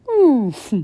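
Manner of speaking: hammed-up creature grunt; walrus grunt. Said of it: "said Walrus".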